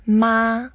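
MA held high and level; first tone. Shown as a demonstration. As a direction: say "ma" on a flat high pitch, without movement. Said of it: "So first tone".

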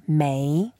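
没 drawn out with a rising tone; second tone. Shown as a demonstration. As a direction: let 没 climb in second tone. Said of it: "It's second tone".

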